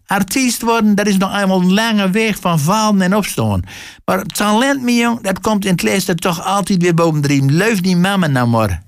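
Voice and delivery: high-pitched motherly pep talk; high, coaxing consolation; encouraging mother. A narrator's reading. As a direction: reassure your boy in a high voice. Mother consoles her failed son.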